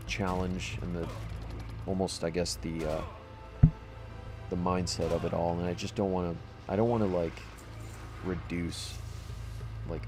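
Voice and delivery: normal talking voice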